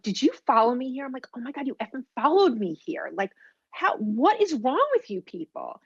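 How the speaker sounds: an indignant outburst at being tailed; exasperated. Retold or imitated. Woman confronting agents who followed her, exasperated.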